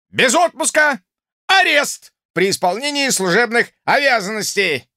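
high, loud furious bellow; shouting drill sergeant. Acted. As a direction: roar the threats at the cadets. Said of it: "И тут он повысил голос до окрика".

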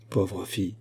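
soft, pitying murmur; talking to himself. A narrator's reading. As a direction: say it softly, for yourself alone. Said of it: "se dit-il à lui-même". (se dire doucement à soi-même)

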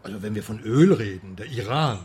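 usual speaking voice

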